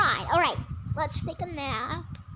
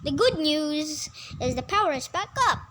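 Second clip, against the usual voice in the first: usual speaking voice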